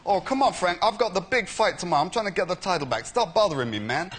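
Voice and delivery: a touch of an irritated boxer brushing off a caller; Lennox Lewis voice. Retold or imitated. Fake Lennox telling the prank caller to stop bothering him. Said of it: "I should do the voice of Lennox".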